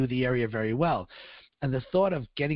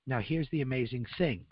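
normal talking voice

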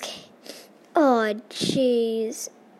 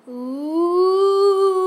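ghost moan; 7 semitones higher, 9 dB louder than usual. Acted. Ghost sound.

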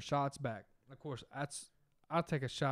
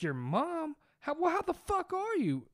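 high loud shocked question; shocked disbelief; 5 dB louder than usual. Retold or imitated. The guys shocked to learn her age, loud disbelief. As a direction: blurt it out in disbelief. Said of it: "and we was like".

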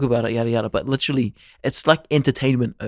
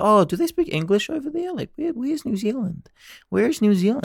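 high-pitched imitation of a baffled foreigner; mock clueless American; 10 semitones higher than usual. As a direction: mimic someone puzzled, pitched well up.

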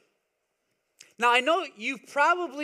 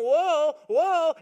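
put-on toddler voice; 5 semitones higher, 6 dB louder than usual. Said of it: "he's going".

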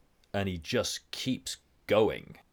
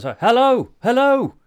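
high, loud call down into a pit; yelling down a hole; 14 dB louder than usual. Retold or imitated. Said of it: "he's just yelling down a hole at them".

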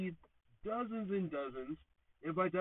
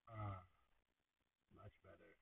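very soft, low murmur of relief; relieved sigh; 11 semitones lower, 16 dB quieter than usual. Acted. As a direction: exhale softly into calm relief. Relaxing after breathing exercise.

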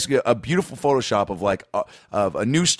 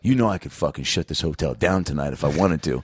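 low mock guard threat; power-tripping guard, low voice; self-important threat; 8 semitones lower than usual. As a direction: put on a mildly menacing low authority. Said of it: "He also was acting from a place of".

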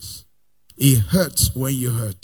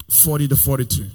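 normal talking voice

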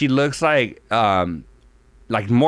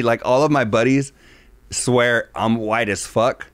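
normal talking voice

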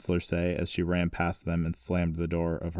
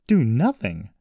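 louder, higher incredulous echo; indignant exclamation; exclaiming; 4 semitones higher, 7 dB louder, slower than usual. A narrator's reading. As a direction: throw it back as a disbelieving question. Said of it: "Jessie exclaimed".

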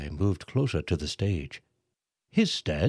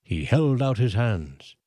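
ordinary delivery

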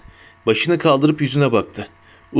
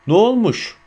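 higher-pitched, mildly raised narrated yell; shouting; 7 semitones higher than usual. A narrator's reading. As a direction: lightly act out an indignant shout. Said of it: "diye bağırıyordu".

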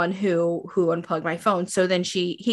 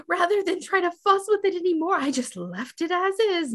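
high put-on voice; hammed-up maid, much higher; mimicking Georgina; 9 semitones higher, faster than usual. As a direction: explain it away brightly in a higher voice. Georgina excuse acted out.

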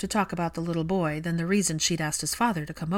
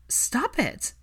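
higher, sharp cry to stop him; sharp outburst; 6 semitones higher than usual. A narrator's reading. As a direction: cut him off, stung. Hermione cries out, tears.

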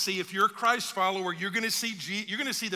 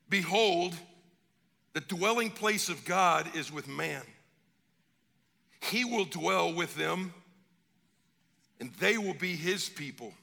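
scripture reading; 4 semitones lower than usual. Acted.

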